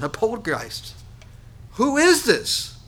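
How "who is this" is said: fearful cry. Acted. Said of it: "They cry out for fear".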